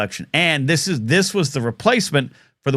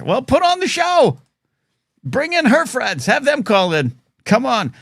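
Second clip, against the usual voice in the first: mock outrage; 10 semitones higher than usual. Mock indignant reply to viewer.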